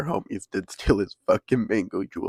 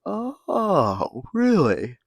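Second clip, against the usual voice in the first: "Oh" jumps far higher, "really" louder; mock amazement; 10 semitones higher, 4 dB louder, much slower than usual. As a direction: play it wide-eyed and stunned. Friends react to liquid LSD.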